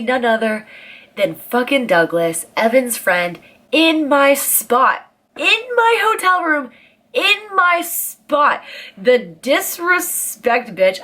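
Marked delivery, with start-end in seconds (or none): 3.7-11.0 slow, much higher, indignant repeats of in my spot; outraged disbelief. Retold or imitated. Finding Douglas asleep in her bed, high scandalised outrage. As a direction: drag out each phrase in scandalised disbelief. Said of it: "pissed me off so much".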